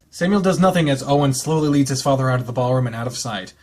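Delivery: calm, even read-aloud narration; story narration. Acted. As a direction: read the novel text evenly, like an audiobook. Reads visual novel narration.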